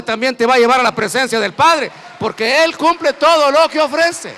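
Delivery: building, rising shouted preaching; preaching at full force. Real, not acted. (predicando con toda la fuerza, cada vez más alto)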